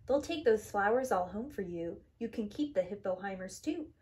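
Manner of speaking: ordinary delivery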